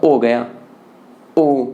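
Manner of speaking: ओ गया with no h and low pitch; Haryanvi ओ गया. Shown as a demonstration. Teacher showing the Haryana form of हो गया, low. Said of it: "वहाँ उच्चारण क्या होगा?".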